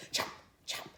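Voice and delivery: ordinary delivery, nothing marked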